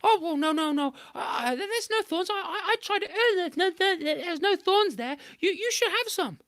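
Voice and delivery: very high sweet wheedling; coaxing trickster. A narrator's reading. Anansi coaxing Monkey toward the honey tree, high and wheedling. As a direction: sell the honey hard.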